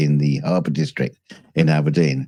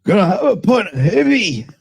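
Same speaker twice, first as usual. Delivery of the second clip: mock Scottish pub voice; 13 semitones higher than usual. Imitating Scottish pub patron.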